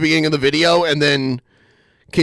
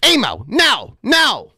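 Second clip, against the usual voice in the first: scolding a dog; 7 semitones higher, slower than usual. Pretend.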